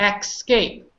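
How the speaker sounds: Escape said as EX-cape; wrong pronunciation. Shown as a demonstration. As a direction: mistaken Escape with a ks at the front. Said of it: "It's not how we say it".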